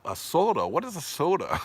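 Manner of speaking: mock incredulous. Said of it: "he said".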